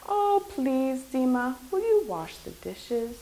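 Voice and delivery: soft, coaxing plea; pleading polite request. Acted. Mother begging her child for help, pleading polite request. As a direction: ask sweetly and softly, as a favor. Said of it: "Your mother doesn't say".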